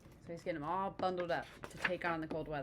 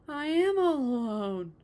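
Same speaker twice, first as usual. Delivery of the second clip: loud, high, slow scream of alarm; 8 semitones higher, 11 dB louder than usual. A narrator's reading. Toad screaming alone on the runaway sled. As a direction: panic as you find yourself alone. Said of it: "screamed Frog".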